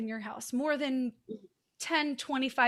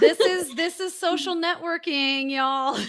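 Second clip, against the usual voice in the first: laughing; 4 semitones higher, 8 dB louder than usual. Real, not acted. Joking after cousin plug.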